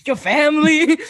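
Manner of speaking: repeating it through laughter; laughing. Real, not acted. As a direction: laugh while saying it. Repeats question while laughing.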